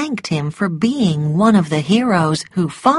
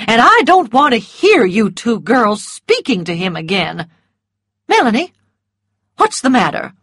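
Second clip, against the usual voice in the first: stern; 4 semitones higher, 4 dB louder than usual. A narrator's reading. Mrs Merriweather scolding.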